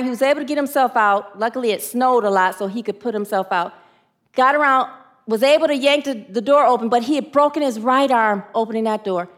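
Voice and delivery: usual speaking voice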